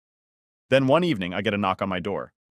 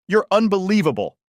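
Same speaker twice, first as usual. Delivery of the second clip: loud, much higher shout of disbelief; furious yell; 10 semitones higher, 6 dB louder than usual. Retold or imitated. Sister yelling at her brother at his door, furious shout. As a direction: hurl it at him in a fury. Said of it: "she yells".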